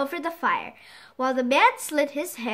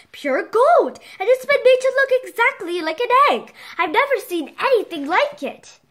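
amazed; 8 semitones higher, 5 dB louder than usual. Acted. Old trader amazed by egg.

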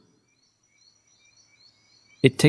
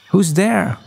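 higher, lightly voiced questioning owl; owl character calling; 5 semitones higher than usual. A narrator's reading. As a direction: call out to whoever answered. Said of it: "Olive said".

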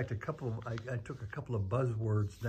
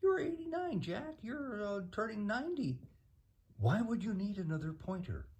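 much higher, softer put-on voice; 12 semitones higher, 3 dB quieter than usual. Interviewer jokes about Jack needing another pointer, high put-on voice. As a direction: pitch it way up, lightly. Voicing a teasing remark to himself.

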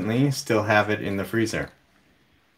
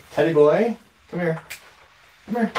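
calling out to the dog; 8 semitones higher, faster than usual. Real, not acted. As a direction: call the dog over, bright and raised.

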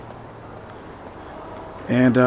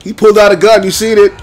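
outraged; 3 semitones higher, 3 dB louder than usual. Real, not acted. Outraged at the game.

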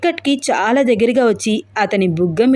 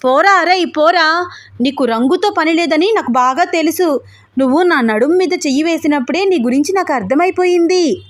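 high-pitched, mildly raised yelling; acted shouting, more pitch than volume; shouted taunts; 6 semitones higher than usual. A narrator's reading. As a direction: jeer at him from a distance. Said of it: "గట్టిగా అరుస్తూ మాట్లాడుతూ".

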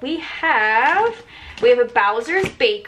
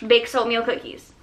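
ordinary delivery

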